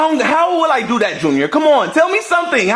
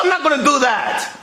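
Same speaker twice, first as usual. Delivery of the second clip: ordinary talk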